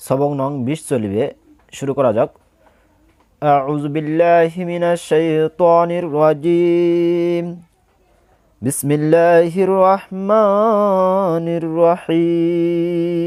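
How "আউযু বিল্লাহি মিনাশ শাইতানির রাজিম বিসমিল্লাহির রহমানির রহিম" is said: slow, drawn-out chanting of the opening formulas; solemn slow recital before teaching; slow Quranic recitation. Real, not acted. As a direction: recite each word stretched and measured.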